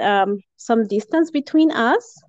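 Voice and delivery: ordinary talk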